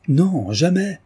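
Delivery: high, loud, passionate refusal; ardent outcry. Acted. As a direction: cry it out with fervour. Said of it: "s'écria my lord Orville avec chaleur". (cri ardent, plus haut et plus fort)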